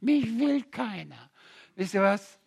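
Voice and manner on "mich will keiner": slow, lightly voiced self-pity; a hint of a whining miserable soul; self-pitying wretch. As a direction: mimic a mopey sinner. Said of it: "verkriechen und sagen".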